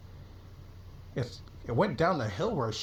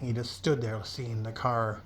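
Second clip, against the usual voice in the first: ordinary delivery, nothing marked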